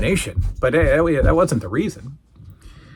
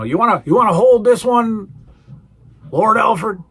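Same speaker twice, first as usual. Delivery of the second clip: higher, louder taunting offer; teasing offer; 7 semitones higher, 4 dB louder than usual. Retold or imitated. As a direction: dangle it at a nervous man, pitched up. Doctor D teasing terrified Lord Alfred.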